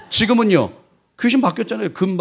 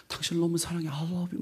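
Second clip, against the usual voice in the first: soft AI lover; 11 dB quieter than usual. Retold or imitated. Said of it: "그러면".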